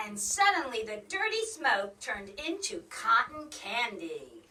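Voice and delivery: ordinary delivery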